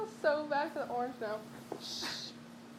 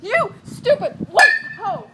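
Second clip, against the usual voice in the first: mock yell; 4 semitones higher, 14 dB louder, slower than usual. Real, not acted. Yelling at the fruit.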